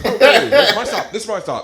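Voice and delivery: loud laughing blurt, high; nervous escape. Retold or imitated. Dodging eye contact on a crowded train, laughing nervously. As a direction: laugh out an excuse and flee. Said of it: "you're just like".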